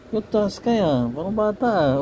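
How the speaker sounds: high, doting pet talk; cooing at a pet. Real, not acted. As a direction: gush over a cute pet in a lifted voice.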